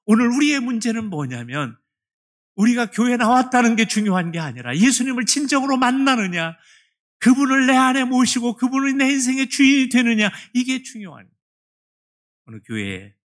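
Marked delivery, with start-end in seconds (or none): 0.0-11.4 loud, high, urgent sermon voice; fervent preaching. Acted. Preacher urging the church to truly meet Jesus, loud and fervent. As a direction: drive it home to the congregation.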